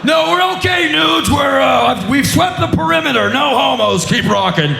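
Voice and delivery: high-pitched shouting up to the stage; hammed-up fan reassuring the band; mock all-clear report. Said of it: "three men around me are like".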